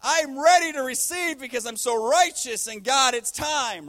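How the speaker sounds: loud, high, hammed-up self-righteous boast; cocky elation. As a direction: proclaim it like someone sure they deserve it. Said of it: "having a great moment".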